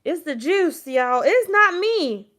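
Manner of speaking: loud, high, drawn-out playful denial; tipsy, hammed-up excuse; loud tipsy joke. Real, not acted. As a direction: blame the juice with a big laughing voice. Tipsy joking to live viewers.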